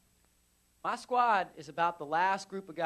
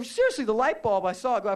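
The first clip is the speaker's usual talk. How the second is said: ordinary delivery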